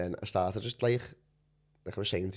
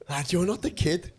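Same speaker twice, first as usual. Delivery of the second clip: stunned disbelief; 7 semitones higher, 5 dB louder than usual. Retold or imitated. Retold stunned recognition.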